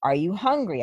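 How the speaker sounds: ordinary talk, nothing marked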